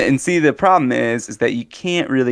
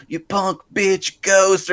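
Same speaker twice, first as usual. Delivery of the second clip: higher, drawn-out mock taunt; taunting TV ghost hunter; 8 semitones higher, much slower than usual. Friend imitating TV ghost hunter, taunting. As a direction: goad the ghost like a TV host. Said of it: "and he was like".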